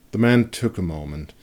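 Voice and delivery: ordinary delivery, nothing marked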